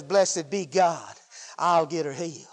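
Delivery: low, hushed assurance; voice of mercy. Acted. Mercy answering for the sick wife, low and hushed. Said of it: "Mercy says".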